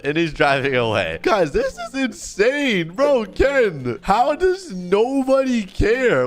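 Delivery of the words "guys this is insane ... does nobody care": high, slow, exasperated appeal; exasperated outcry. Real, not acted. Streamer to viewers after nobody helped, exasperated. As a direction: cry out in dismayed disbelief.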